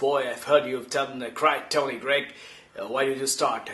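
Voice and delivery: a hint of Tony Greig greeting the boy. Tony Greig lightly voiced, greeting the young imitator. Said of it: "then he says in his voice".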